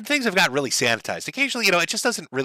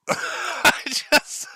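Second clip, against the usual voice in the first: laughing; 10 semitones higher than usual. Real, not acted. Reactor cracks up laughing.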